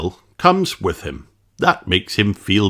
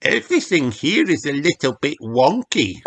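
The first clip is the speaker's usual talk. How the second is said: put-on little girl voice; 7 semitones higher than usual. A narrator's reading. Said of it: "Peppa gasps".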